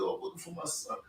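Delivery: father's hushed ask, barely voiced; mild hush while quoting father; quiet quote. Father quietly asks for son.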